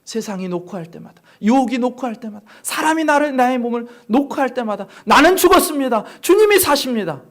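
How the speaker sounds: 높고 큰 목소리로 몰아치는 설교; 구절마다 힘을 실어 외치듯 설교. Real, not acted. (목청 높여 힘차게 설교)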